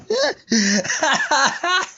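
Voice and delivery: high bursting laugh; burst of laughter. Real, not acted. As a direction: crack up at your own joke.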